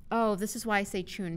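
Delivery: usual speaking voice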